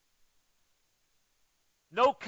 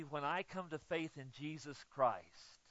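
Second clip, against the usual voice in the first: ordinary talk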